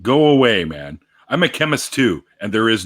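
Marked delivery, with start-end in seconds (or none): 0.0-0.7 loud, exasperated dismissal; annoyed. Real, not acted. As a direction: shoo him off, fed up. Scolding commenter on screen.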